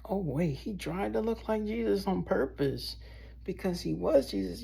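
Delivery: high voice of sudden realization; realizing onlooker. Retold or imitated. A passerby realizing he is Jesus, high and surprised. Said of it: "and then it's like".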